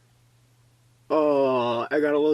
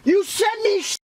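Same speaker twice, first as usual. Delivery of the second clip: shrieking disbelief, far higher; real shock breaking into fury; shocked outrage; slower than usual. Real, not acted. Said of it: "I lost my mind a bit there".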